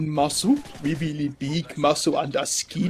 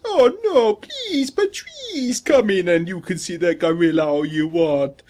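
hammed-up terrified plea in a falsetto; pleading zookeeper; 3 semitones higher than usual. Voices frightened zookeeper begging in story.

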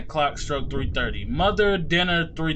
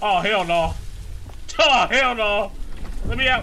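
panicked; 5 semitones higher than usual. Real, not acted. Trapped, panicking.

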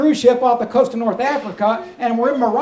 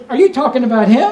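incredulous wife; 6 dB louder than usual. Acted. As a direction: play the wife's disbelief, voice rising at the end. Wife's disbelieving question in joke.

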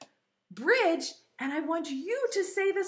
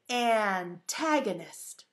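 slow, lower model of the word; word demo; 4 semitones lower, much slower than usual. Shown as a demonstration. Teacher modelling a new word for preschoolers, slowly. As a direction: say it slowly for repeating.

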